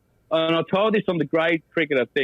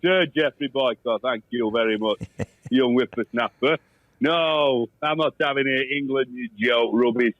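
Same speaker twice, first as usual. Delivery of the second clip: Geoffrey Boycott impression; 2 semitones lower than usual. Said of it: "What would, uh, Jeffrey Boycott make of that".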